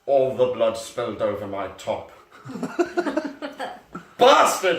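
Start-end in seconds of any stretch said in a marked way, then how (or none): 2.4-4.1 real giggle fit, high; high laughing fit. Real, not acted. Reader laughing at the absurd fic. 4.2-4.8 loud, high laughing yell of bastard; angry shout. Acted. Reader shouting as Ebony at the boy who spilled blood. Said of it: "I shouted angrily".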